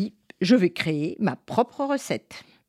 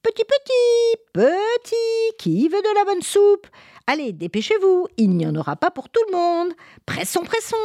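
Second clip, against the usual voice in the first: witch calling animals; 13 semitones higher than usual. Acted. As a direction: call the pets to their soup. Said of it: "en criant".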